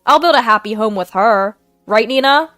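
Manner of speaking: loud, confident declaration; husband confidently choosing mistress, loud. Acted. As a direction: announce it with certainty. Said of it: "Julian confidently said".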